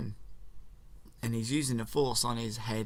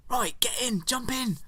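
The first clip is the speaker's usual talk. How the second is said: high, loud eager hurry-up; high excited call to get moving; excited urging; 12 semitones higher, 4 dB louder than usual. Retold or imitated. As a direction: rush him into the ship, thrilled. Urging escape into tie fighter.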